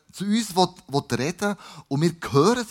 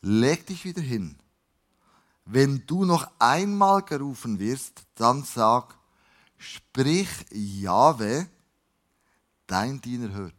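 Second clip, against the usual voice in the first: lower, measured instruction with long pauses; scripture storytelling; 4 semitones lower, slower than usual. A narrator's reading. Eli tells Samuel what to answer, measured scripture reading. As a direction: speak as an elder giving careful instruction. Reads Eli's instruction aloud.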